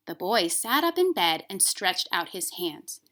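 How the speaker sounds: put-on reading a children's book aloud, gently expressive; story narration. A narrator's reading. Teacher reads the Velveteen Rabbit to her class, storybook narration. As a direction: read it like a bedtime story.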